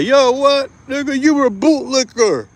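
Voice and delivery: high, whiny mock voice; mocking internet critics. Mocking internet commenters who call him a bootlicker, high and whiny. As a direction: sneer it like a sarcastic online troll. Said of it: "trying to say".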